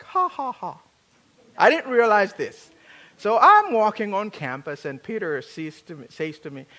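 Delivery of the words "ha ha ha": loud, high "ha ha ha" laugh; hearty laugh. Shown as a demonstration. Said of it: "I laugh something like".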